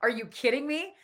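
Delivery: high, slow, laughing oh my God; high disbelieving laugh at bad news; laughing disbelief. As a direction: laugh through the shock. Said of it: "I was like".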